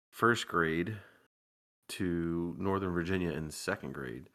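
ordinary talk

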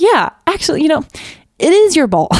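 high, laughing surrender; half-laughing, give in with a lighter voice; giving in. Retold or imitated. Mother retelling how she gave in to her toddler, high and amused. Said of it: "I'm like".

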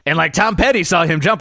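normal talking voice

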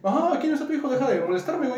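high, put-on voice for Eusebio; high-pitched brush-off. Eusebio telling the worried father to stop bothering him, high-pitched brush-off.

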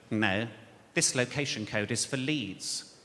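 low, flat jobsworth drone; parking officer. Retold or imitated. Comedian voicing the parking officer, low jobsworth drone.

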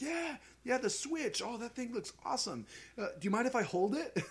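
high-pitched imitation of an Australian waiter; Australian accent. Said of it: "he had, like, an Australian accent".

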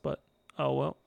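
usual speaking voice

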